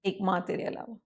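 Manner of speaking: ordinary delivery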